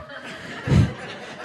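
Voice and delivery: whispered laugh. Real, not acted. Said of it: "laughing whisper".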